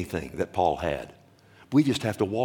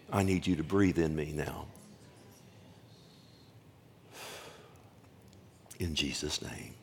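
softer, hushed and halting, short of air; earnest prayer; 6 dB quieter than usual. Real, not acted. Pastor praying over his own cough, hushed prayer. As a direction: pray quietly, straining for breath. Prays through his allergy attack.

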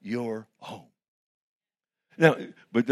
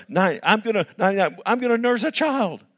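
higher and louder in disbelief; astonished Sarah; 8 semitones higher, 4 dB louder than usual. Retold or imitated. Sarah amazed at nursing a child, high and incredulous. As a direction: marvel at it, voice climbing.

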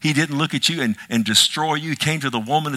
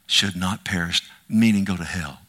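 ordinary talk, nothing marked

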